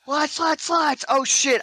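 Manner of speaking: high, alarmed yelling; panicked wake-up. Retold or imitated. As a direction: snap awake in alarm. Waking up panicked in boot camp.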